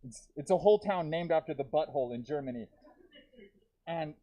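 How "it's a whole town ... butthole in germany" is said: ordinary delivery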